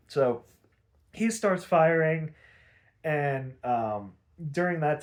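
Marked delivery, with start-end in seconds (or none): none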